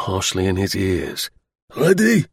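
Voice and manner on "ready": high, barked military order; drill-ground call, pitched up; bellowed command. Acted. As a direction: bellow it to the firing squad. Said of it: "bellowed the non-commissioned officer".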